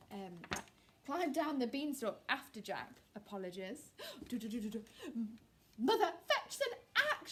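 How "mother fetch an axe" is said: much higher, louder shout. A narrator's reading. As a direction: call urgently to mother. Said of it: "shouted Jack".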